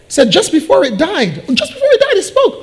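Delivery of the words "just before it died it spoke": very high-pitched, incredulous squeak; astonished echo. Acted. Shop manager hears the parrot spoke, astonished. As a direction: echo it in acted amazement. Manager echoes news in amazement.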